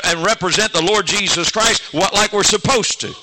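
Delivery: loud, high preaching call to stand up; preaching at full force. Acted. Preacher exhorting the congregation, loud fervent preaching.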